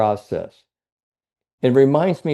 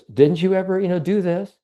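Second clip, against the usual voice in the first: put-on higher, eager young cat; young tomcat; 8 semitones higher than usual. Young tomcat asks old Tom on the fence, higher voiced. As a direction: play the cocky young tomcat asking. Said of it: "And he goes".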